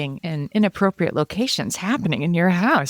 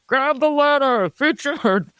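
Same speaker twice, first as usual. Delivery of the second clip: high, slow calls as if amplified by a megaphone; through a megaphone; 9 semitones higher than usual. Retold or imitated. State trooper calling a stranded man, megaphone call. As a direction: boom it out like a bullhorn. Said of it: "Excellent megaphone special effect".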